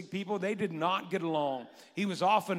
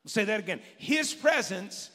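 louder, higher preaching build; full-force preaching; 6 semitones higher, 4 dB louder than usual. Acted. Pastor exhorting the congregation, full-force preaching. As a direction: preach it with fire.

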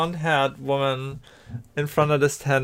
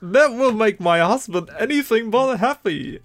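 lifted, higher woman's voice; high NPC woman voice; 7 semitones higher than usual. Acted. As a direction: play the townswoman a pitch up.

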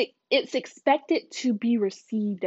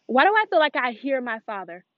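ordinary talk, nothing marked

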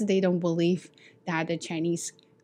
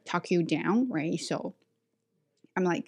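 usual speaking voice

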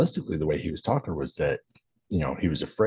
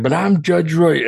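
indignant, much higher and louder, slow; arrogant; 11 semitones higher, 8 dB louder, slower than usual. Retold or imitated. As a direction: proclaim it like the title should open doors. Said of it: "he is that arrogant".

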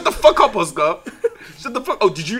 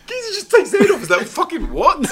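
laughing; 12 semitones higher, 4 dB louder than usual. Real, not acted. Laughing retelling.

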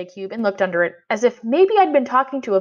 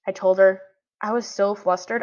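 ordinary delivery, nothing marked

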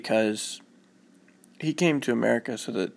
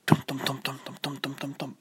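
rapid "tum tum" running noise; running footsteps; 2 semitones higher, 5 dB quieter, faster than usual. Acted. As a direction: imitate running feet, fading softer. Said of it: "he would run home".

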